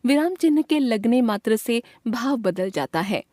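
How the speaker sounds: ordinary talk, nothing marked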